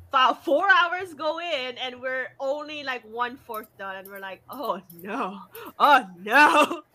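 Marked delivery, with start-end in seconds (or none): 4.5-6.8 dismayed oh no, building louder; rising dismay. Retold or imitated. Retold panic over deadline, building.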